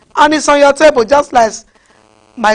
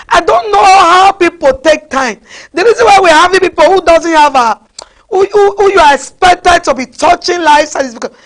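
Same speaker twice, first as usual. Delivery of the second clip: high, loud, tearful preaching cry; 5 semitones higher, 4 dB louder than usual. Real, not acted. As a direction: cry it out over the congregation. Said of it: "preacher crying out".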